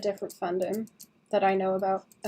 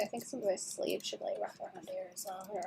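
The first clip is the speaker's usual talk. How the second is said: whispered to herself; 2 semitones lower, 16 dB quieter than usual. Real, not acted. Artist whispers to herself while fixing a sleeve. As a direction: murmur it under breath while drawing.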